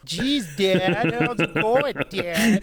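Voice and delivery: high, slow mocking voice; loud, drawn-out mockery, pitched high; teasing kids' impression. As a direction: mimic kids sending up their dad. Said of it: "they make fun of me".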